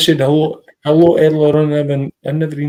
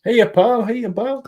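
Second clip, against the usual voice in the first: greeting the dog; 6 semitones higher, slower than usual. Real, not acted.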